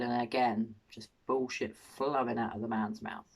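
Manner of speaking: ordinary delivery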